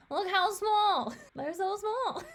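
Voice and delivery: high, slow, gushing coo with laughs; cooing delight. Real, not acted. Fan gushing over how small the turtles look, cooing. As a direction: coo over something tiny and cute.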